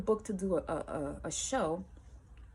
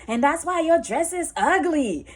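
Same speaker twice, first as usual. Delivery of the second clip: high, loud mocking jab with a laugh; sneering insult; 11 semitones higher, 10 dB louder than usual. Ex mocks her dress, loud and sneering.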